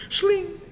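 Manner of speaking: high sharp shling sound effect; sword slice sound. Pretend. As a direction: voice the blade slicing through.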